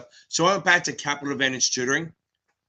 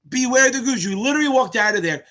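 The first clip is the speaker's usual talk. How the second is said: higher-pitched, emphatic cheering; excited hype; 7 semitones higher, 4 dB louder than usual. Real, not acted. Streamer hypes a student fresh out of the exam, excited. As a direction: pump up the student who just passed.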